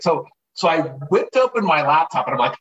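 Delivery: ordinary delivery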